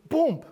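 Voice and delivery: a sudden burst like a blow; sudden boom. Pretend. Preacher shouts the killing blow, high and loud.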